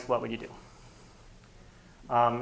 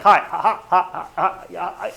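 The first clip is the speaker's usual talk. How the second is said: awkward forced laughter; fake polite laugh; 5 semitones higher, 12 dB louder than usual. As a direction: laugh along with nobody in particular. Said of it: "it's like".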